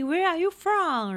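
a hint of a flirty stranger; retold pickup line. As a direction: imitate a stranger's friendly opener. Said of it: "黑人第一句话就问说".

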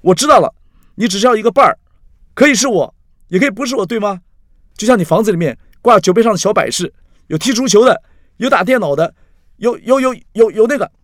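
high, heated shouted tirade; loud accusation. A narrator's reading. Axi stands pointing at Carrie, loudly ranting. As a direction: rant at full voice, pointing the blame. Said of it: "他站起来指着Carrie大声地说".

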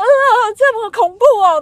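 very high, loud mock alarm; shocked mom. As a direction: play the mom gasping at surgery news. 妈妈听到手术吓到. (模仿妈妈高声惊呼这么恐怖)

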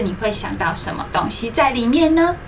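usual speaking voice

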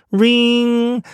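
high, long-held "riiing"; phone ring. Shown as a demonstration. Teacher imitating a phone or alarm bell, high sustained ring. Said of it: "那就是所谓的电话声啦".

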